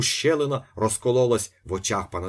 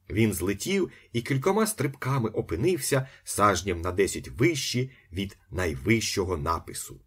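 usual speaking voice

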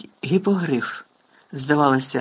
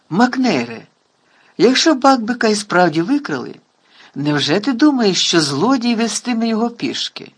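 amused; 6 semitones higher, 5 dB louder than usual. A narrator's reading. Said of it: "весело запитав його Дамблдор".

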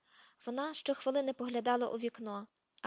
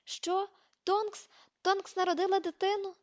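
much higher, squealing in disbelief; excited shriek; 9 semitones higher, 5 dB louder than usual. A narrator's reading. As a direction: shriek it out, thrilled and stunned. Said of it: "Герміона заверещала".

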